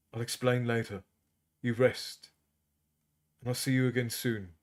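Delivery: calm her so she will rest; soft reassuring husband; tender reassurance. A narrator's reading. Arutha soothing his wife.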